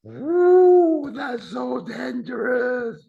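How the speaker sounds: high, loud, drawn-out "ooh" of danger; hammed-up warning, stretched out high; mock alarm. Mock alarm at the risky phrase.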